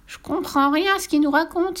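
high-pitched flustered grandmother; panicking grandmother. Acted. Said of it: "s'affola sa grand-mère".

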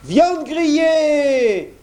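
very high, slow mock blacksmith cry; imitating the blacksmith. A narrator's reading. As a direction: fake the blacksmith to scare the wolf. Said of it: "en imitant la voix du forgeron".

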